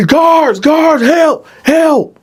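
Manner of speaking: very high, loud desperate yell; calling for help. Retold or imitated. Dylan yelling for the guards, loud desperate high cry. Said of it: "and you hear Dylan".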